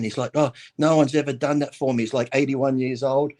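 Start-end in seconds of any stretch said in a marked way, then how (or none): none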